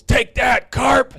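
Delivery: high, loud triumphant yell at the pitcher; playful taunting shout. Retold or imitated. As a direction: crow it out like you just won a duel. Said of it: "and he goes".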